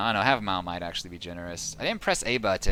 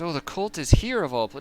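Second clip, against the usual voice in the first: mock surprise; 7 semitones higher, 4 dB louder than usual. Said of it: "like, surprised".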